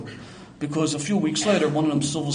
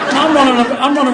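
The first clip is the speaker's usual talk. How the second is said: louder, higher, laughing through it; 8 semitones higher, 10 dB louder than usual. Real, not acted. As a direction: laugh while telling it. Excited recounting, laughing.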